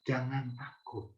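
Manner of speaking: soft and slow reassurance; gentle, tender. Shown as a demonstration. Preacher showing how Jesus really said it, tender and slow. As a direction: say it softly and slowly to comfort.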